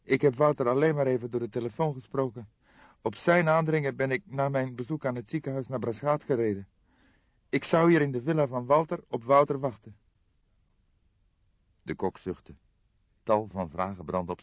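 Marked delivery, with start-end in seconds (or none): 0.0-10.0 put-on higher, tense young woman's voice; a shocked young woman breaking bad news; young woman Jolanda. Jolanda explains, distressed. (geschokte jonge vrouw, hogere stem)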